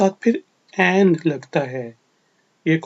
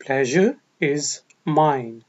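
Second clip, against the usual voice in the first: slow, careful model of "Pleasure is mine"; deliberate teaching pace; slow model phrase; 2 semitones lower, much slower than usual. Shown as a demonstration. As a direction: stretch it out for students to repeat. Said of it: "ये मेरे लिए खुशी की बात है।".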